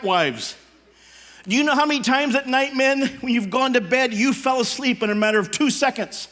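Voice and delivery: normal talking voice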